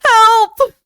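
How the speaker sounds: high, loud mock cry for help; jokey yelp of alarm at an alert; mock panicked cry for help. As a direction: squeal it like a half-joking panic. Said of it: "I'm like".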